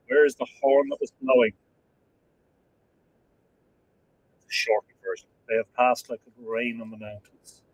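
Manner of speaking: solemn poem recitation. Acted. Quoting a beloved film verse to viewers, solemn recitation. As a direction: recite it gravely, letting lines fall away.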